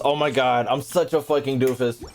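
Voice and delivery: usual speaking voice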